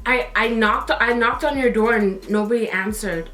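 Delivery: low, halting retold excuse; mildly tearful stammer, pitched low; about to cry. Lying to cousin about knocking, mildly about to cry. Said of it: "I was, like, about to cry".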